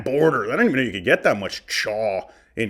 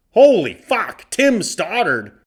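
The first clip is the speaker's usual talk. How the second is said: loud, higher burst, then name drawn out slowly; shocked exclamation; 7 semitones higher, 6 dB louder, slower than usual. Real, not acted. Vlogger shocked by a giant mustache, bursting out loud. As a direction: gasp out loud at the sight.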